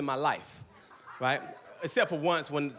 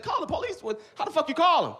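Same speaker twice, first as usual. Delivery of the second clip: baffled disbelief; 9 semitones higher than usual. Retold or imitated. Baffled at calling police.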